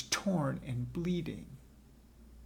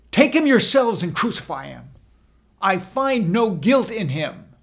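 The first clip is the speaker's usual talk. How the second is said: loud, higher retort; raised voice; 4 semitones higher, 10 dB louder than usual. A narrator's reading. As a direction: shout back at the crowd. Pilate answers the shouting crowd.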